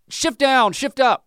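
high-pitched coaching call from behind; barked coaching order. Retold or imitated. Dad coaching his son from behind on the trail, barked order. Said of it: "I can bark orders".

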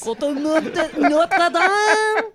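put-on French accent; mock-French accent; French guy. Shown as a demonstration. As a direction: draw out landmarks in a hammed-up French accent. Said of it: "give us your French guy".

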